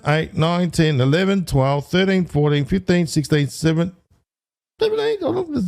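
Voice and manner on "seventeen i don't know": surprised. Real, not acted. Count goes wrong mid-tally.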